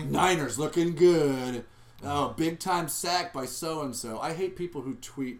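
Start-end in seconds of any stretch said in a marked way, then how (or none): 0.0-4.2 loud, slow, drawn-out fan cheer; mock fan excitement. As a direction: parody an overexcited sports tweeter, stretching it out. Mocks a friend's game tweets.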